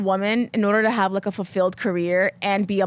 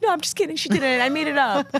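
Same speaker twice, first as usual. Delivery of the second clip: higher, sheepish take-back; backpedaling quote; 5 semitones higher than usual. Retold or imitated. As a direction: quickly play it off, higher. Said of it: "I'm like".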